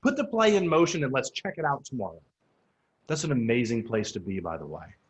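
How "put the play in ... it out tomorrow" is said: fired up to just try it; excited. Retold or imitated. New agent telling the team, eager excited rush. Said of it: "You're excited about things".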